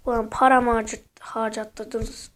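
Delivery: normal talking voice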